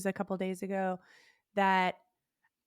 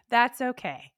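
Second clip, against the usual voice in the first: ordinary talk, nothing marked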